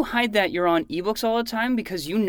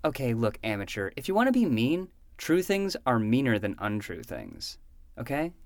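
condescending lecture; 6 semitones lower than usual. Acted. Condescending lesson to amateur.

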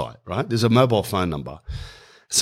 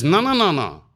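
high, rapid-fire objection, acted out; alarmed refusal; 8 semitones higher than usual. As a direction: shut it down in a raised pitch. Pub man objects to gear.

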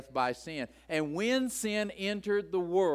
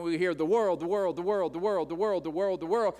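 rapid-fire "the world" repeated, each "world" punched and a bit louder; machine-gun repetition; 2 semitones higher, 3 dB louder, faster than usual. Shown as a demonstration. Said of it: "in this machine gun fashion".

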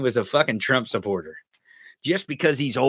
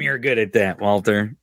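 normal talking voice

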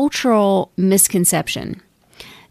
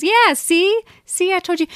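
much higher, mocking ego voice; smug ego; 11 semitones higher than usual. The ego gloating after a stumbling block, high and mocking. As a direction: put on a high, told-you-so voice. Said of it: "Ego goes".

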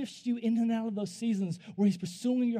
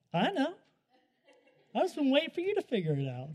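higher-pitched imitation of a wife; mock wifely "told you so", pitched up; imitated knowing wife; 5 semitones higher, faster than usual. Wife's knowing reply, voiced.

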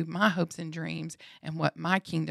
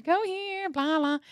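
stage announcer voice; 12 semitones higher than usual. Retold or imitated. Mimicking chatter from the stage, high announcer voice.

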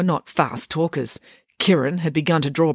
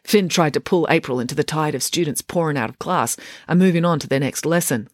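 usual speaking voice

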